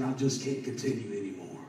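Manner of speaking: low, soft, understated imitation of weeping; retold despair. Weeping man confides in his preacher, understated despair. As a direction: let the quote sink, subdued. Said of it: "He was crying and weeping".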